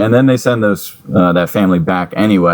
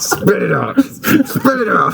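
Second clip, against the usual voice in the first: laughing command; slower than usual. Pretend.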